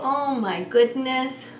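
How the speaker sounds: ordinary delivery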